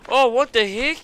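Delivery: loud, high-pitched disbelief; real gamer outburst of surprise; startled shout. Real, not acted. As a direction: yell it in shock. Startled by event in game.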